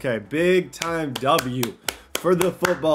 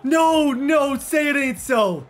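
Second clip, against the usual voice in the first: loud high cry of no; loud despairing no, no, no; anguished shout; 10 semitones higher, 8 dB louder, much slower than usual. Real, not acted. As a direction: wail it in disbelief. Star player gets hurt.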